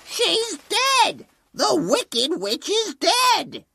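monkey cheers. Acted. Flying monkey announces the witch is dead, high. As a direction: minions spreading the big news.

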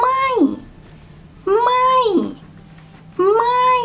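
ไม่ launched high, in falling tone. Shown as a demonstration. Teacher demonstrating the third tone, high-starting falling ไม่. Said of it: "the next one is in falling tone".

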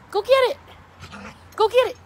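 high, loud urging to fetch; excited calling out. Real, not acted. Owner urging a reluctant dog to fetch, excited calling out. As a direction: call out to send the dog running.

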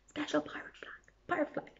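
soft unvoiced whisper. Shown as a demonstration. Teller whispering the clue to the children. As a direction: hush it like a secret password. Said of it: "We have to remember that".

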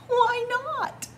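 very high, carefree storybook cry. A narrator's reading. Dancing animals answer Fox's question, crying out. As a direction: pipe it up high as a retort. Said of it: "they cried".

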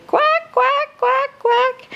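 very high squeaky duck quacks. Acted. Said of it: "Mother Duck said".

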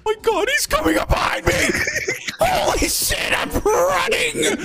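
high panicked shouting; being chased. Real, not acted. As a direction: yell while running for your life.

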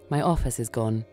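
ordinary delivery

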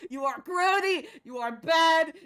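loud, high hammed-up scolding; mock-outraged shouting; shouted insults. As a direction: punch out each insult at full volume. Hammed-up insults at game character.

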